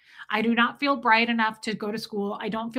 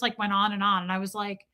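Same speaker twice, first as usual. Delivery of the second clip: usual speaking voice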